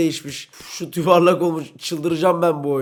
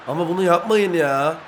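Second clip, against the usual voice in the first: usual speaking voice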